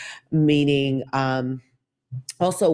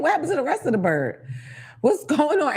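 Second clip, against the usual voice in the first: higher, fast incredulous questions; 10 semitones higher than usual. Real, not acted. To the drive-through, high incredulous complaint. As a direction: pitch the disbelief way up. Ranting about missing chicken parts.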